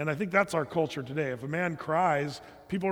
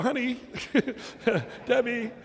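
higher, laughing call for his wife; half-laughing plea for rescue; mock call for help; 6 semitones higher than usual. Pastor jokingly calling his wife Debbie over, laughing. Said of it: "I wanted to go".

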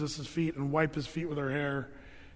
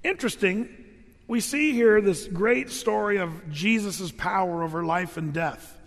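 ordinary talk, nothing marked

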